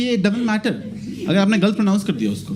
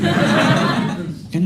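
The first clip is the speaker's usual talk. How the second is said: normal talking voice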